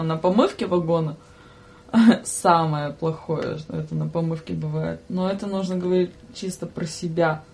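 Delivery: ordinary talk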